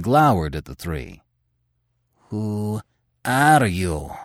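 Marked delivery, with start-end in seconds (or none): 2.3-4.1 slow question. Acted. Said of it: "he asked slowly".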